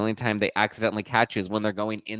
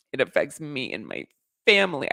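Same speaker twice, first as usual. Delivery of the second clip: higher sarcastic parody of "It affects me and my family."; mocking repeat; 5 semitones higher than usual. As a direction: sneer "family" as a fake-wounded whine. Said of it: "But, like, come on".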